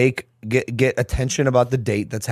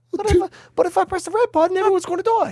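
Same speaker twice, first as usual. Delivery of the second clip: much higher, fast put-on squeak; squeaky high voice; faster than usual. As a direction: pitch way up into a cartoon voice. Mock voice about the buttons.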